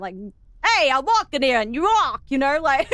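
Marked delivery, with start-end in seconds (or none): 0.6-2.2 a hammed-up New York accent, louder; New Yorker accent. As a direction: play a brash New Yorker, lightly. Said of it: "in a New Yorker accent".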